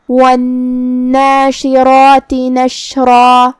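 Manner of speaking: very slow chant, holding the nn of والناشرات as a long hum; measured tajweed chant with a lingering nn in والناشرات; doubled noon recitation. Shown as a demonstration. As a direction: stretch the noon in والناشرات, then draw out the vowels. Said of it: "حرف النون کو دو حرف کے برابر".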